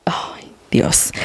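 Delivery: hushed, voiceless exclamation; imitated whisper. Retelling what Andrea was like, imitated whisper. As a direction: breathe it out as a soft whisper. Quoting Andrea's awed reaction.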